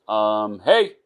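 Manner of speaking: hey pushed up high and loud; calling out. Acted. Calling unanswered into darkness.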